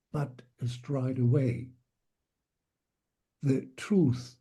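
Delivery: ordinary delivery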